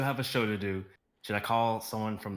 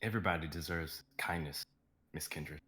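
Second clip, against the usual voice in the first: low, soft parting words; a kind goodbye; tender; 5 semitones lower, 5 dB quieter than usual. Acted. Daniel's tender parting line.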